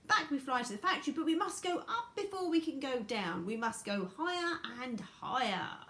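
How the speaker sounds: high, urgent character voice sinking toward the end; Mister Wonka. Acted.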